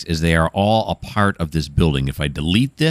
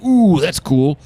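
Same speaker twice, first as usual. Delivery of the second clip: bright, higher, louder little coo; delighted at a find; excited; 9 semitones higher, 4 dB louder, slower than usual. Retold or imitated. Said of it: "be like".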